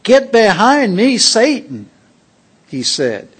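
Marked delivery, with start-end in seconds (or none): 0.0-1.9 loud, higher rebuke; Jesus rebuking Peter sternly. Acted. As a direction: rebuke him sharply. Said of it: "Rebuked Peter".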